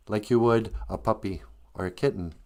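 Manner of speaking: plain reading voice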